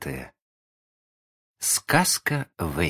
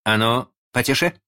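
raised, higher scolding voice; sharp reprimand; 6 semitones higher, 4 dB louder than usual. A narrator's reading. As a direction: call the boys to order. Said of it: "прикрикнул на мальчиков Питер".

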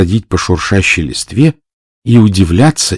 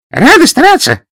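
very high squeak, a bit louder; squeaky voice; 4 dB louder than usual. Acted. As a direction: squeak it out eagerly. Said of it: "неожиданно писклявым голосом".

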